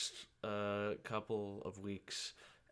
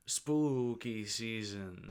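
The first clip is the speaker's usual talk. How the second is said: spooky announcer; 6 dB louder, much slower than usual. Acted.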